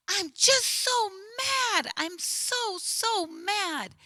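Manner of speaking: put-on high, slow child's voice, angry and hurt; upset little boy. Counselor voicing the angry second-grader, high and slow.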